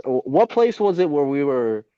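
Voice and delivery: ordinary talk, nothing marked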